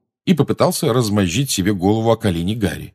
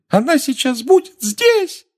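wailing elf voice; slower than usual. Acted. Dobby wailing on.

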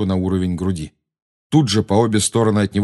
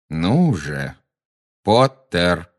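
slow prodding; much slower than usual. A narrator's reading. Malfoy slowly prodding Potter again.

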